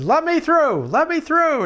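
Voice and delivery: high loud plea to pass; mock panicked high shout; urgent shout. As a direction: yell to clear the way. Driving tractor through the gate.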